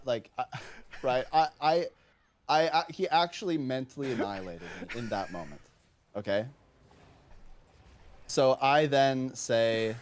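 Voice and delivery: usual speaking voice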